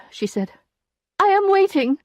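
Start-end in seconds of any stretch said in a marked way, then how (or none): 1.2-2.0 high, loud, insistent; Emma's voice. A narrator's reading. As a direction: press the lawyer, high and firm. Emma demanding money.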